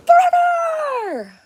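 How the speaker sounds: high, slow crying yell; crying shout. Retold or imitated. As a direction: wail it, very high and loud. Have you ever watched that from The Sandlot?.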